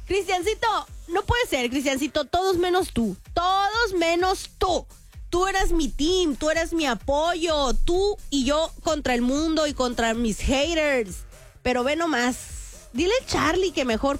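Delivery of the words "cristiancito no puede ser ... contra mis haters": high, drawn-out dramatic reproach; mock betrayal. Streamer reproaching loyal fan for teasing, hammed-up hurt. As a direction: lament like a betrayed teammate, slowing down.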